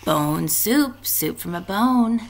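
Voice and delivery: usual speaking voice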